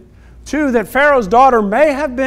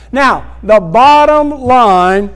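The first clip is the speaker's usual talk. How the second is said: preaching at full force; 3 dB louder, slower than usual. Acted. Sermon climax.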